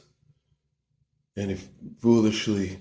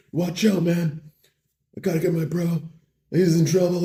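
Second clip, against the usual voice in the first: high, pressed warning; mock urgency; 8 semitones higher than usual. Bro rushes past deer to save his friend, urgently. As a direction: clear the way in a hammed-up rush.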